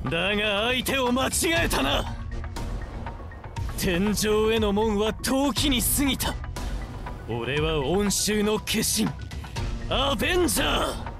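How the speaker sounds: high-pitched, impassioned villain's boast; theatrical avenger in full cry; menacing battle declaration. Acted. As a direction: proclaim your title to doom the foe. Avenger's battle declaration against the Executor.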